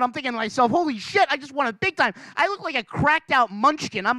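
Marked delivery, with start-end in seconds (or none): 0.7-2.1 excited. Retold or imitated. Excited inner thought at jackpot.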